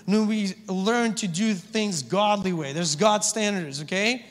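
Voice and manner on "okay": usual speaking voice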